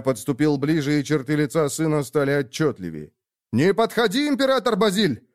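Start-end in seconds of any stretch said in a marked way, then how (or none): 3.5-5.3 high urgent warning shout. Acted. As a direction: cry out to stop him. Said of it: "выкрикнул Абу".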